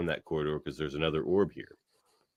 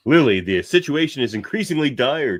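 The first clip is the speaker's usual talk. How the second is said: louder, higher hint of alarm; mock urgent warning; 7 semitones higher, 7 dB louder than usual. Talir urging Lily at the door, mock urgent warning. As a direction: warn her with hammed-up urgency. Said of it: "Talir says".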